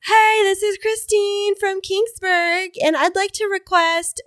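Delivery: put-on high, slow kid on the radio; kid caller voice. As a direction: call in like an eager kid. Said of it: "just to be like".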